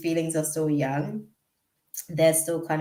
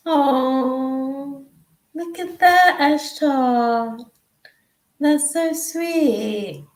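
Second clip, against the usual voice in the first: touched; 8 semitones higher, much slower than usual. Real, not acted. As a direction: melt at something sweet. Reacting tenderly to sweet chat messages.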